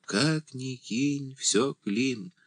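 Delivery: put-on slow, high old-woman lament; sighing. A narrator's reading. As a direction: draw it out softly like a sigh. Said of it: "вздохнула старуха".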